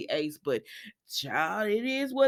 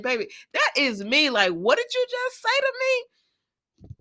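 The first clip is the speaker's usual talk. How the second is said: high, slow shocked disbelief; 13 semitones higher, 3 dB louder than usual. Retold or imitated. To the TV, shocked at Nicholas's news. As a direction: gasp it out in disbelief. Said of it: "'cause I said".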